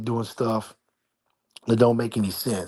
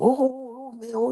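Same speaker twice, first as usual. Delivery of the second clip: sheepish neighbour; 13 semitones higher than usual. Retold or imitated. Neighbour caught throwing trash.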